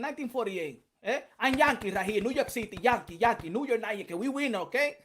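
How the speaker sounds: put-on lightly Latin-accented trash talk; a hint of a boxer's accent, cocky and mocking; Ricardo Mayorga style. Taunting Punch Drunk as a rival boxer, light accent. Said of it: "Ricardo Mayorga, uh, um, Punch Drunk style".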